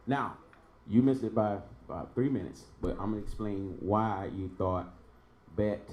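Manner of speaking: usual speaking voice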